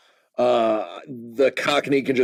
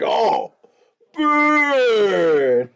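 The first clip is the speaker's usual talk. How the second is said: high, drawn-out teasing howl; mock burn reaction; 7 semitones higher than usual. Host mimicking a viewer's reaction to the joke, high mock howl. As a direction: crow it high, imitating a gloating heckler.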